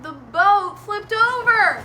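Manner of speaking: slow, high, loud build; dramatic climax. A narrator's reading. Storyteller tells children the boat flipped, dramatic. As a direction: stretch it out loudly for the big moment.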